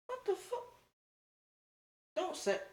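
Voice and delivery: high, hushed what the fuck; stunned squeak. Retold or imitated. Reacting to four fifty a week, a hushed high stunned squeak. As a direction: caught off guard, half gasp. Shocked by the claim.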